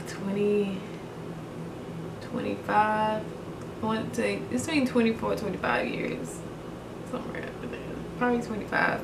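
soft, breathy and tearful, trailing to a whisper; holding back tears while remembering her dad; choked up, near tears. Real, not acted. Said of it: "I'm getting too emotional".

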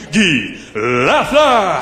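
hugely drawn-out rink announcer's goal call; very slow, climactic announcer shout of the scorer's name; Claude Mouton. Acted. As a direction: stretch GUY LAFLEUR out to rouse the whole arena. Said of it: "Claude Mouton would let you know".

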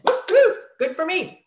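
high, loud whoop of delight; gleeful cheer. Retold or imitated. Cheering her discount, a high loud whoop. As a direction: whoop like you won a prize. Cheering herself about half-price products.

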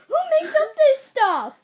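loud, high laughing shout. Real, not acted. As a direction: crack up while shouting. Laughing outburst at the question.

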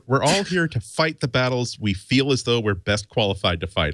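ordinary talk

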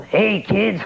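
high-pitched cartoon clown greeting; Krusty the Clown. Shown as a demonstration. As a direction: hail the kids as a TV clown. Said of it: "uh, Krusty the Clown".

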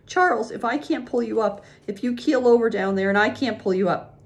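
high, worried protest; frightened wife arguing; anxious pleading. A narrator's reading. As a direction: plead in rising alarm, loud and high. Ma fears she can't save Pa.

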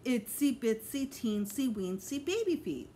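put-on slow sing-song baby talk; cooing. A narrator's reading. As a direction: coo it to the little girl. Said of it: "Mister Fargo cooed".

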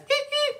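very high-pitched little monkey laugh; chimp giggle. Acted. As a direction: squeal a tiny chimpanzee giggle. Chimpanzee sound after request.

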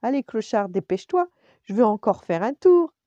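eager urging. A narrator's reading. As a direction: hurry a friend along, building to a shout. Lady eagerly demands another ride. (impatiente et enthousiaste, de plus en plus fort)

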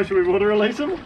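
usual speaking voice

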